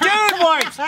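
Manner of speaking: bursts out high with delight at the gift; excited shout. Real, not acted. Opening a fan package, delighted by the wipes.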